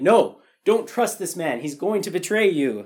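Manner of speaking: loud, high, hammed-up warning; mock alarmed warning. Viewer warning against a traitor, mock alarm. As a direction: cry out a mock warning about a villain.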